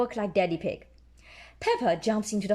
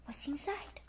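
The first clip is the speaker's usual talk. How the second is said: soft, higher curious hush; quiet excited whisper-like voice; hushed wonder; 5 semitones higher, 13 dB quieter than usual. A narrator's reading. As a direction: ask it softly, full of wonder.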